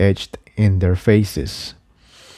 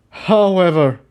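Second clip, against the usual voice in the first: calling over the din; 8 semitones higher than usual. Acted. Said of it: "Starburst called over the din".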